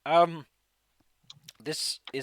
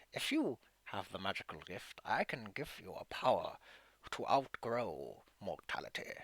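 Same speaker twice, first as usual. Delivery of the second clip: eerie whisper; 6 semitones lower, 8 dB quieter than usual. Acted. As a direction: tempt them with lichdom. DM voicing dark gift.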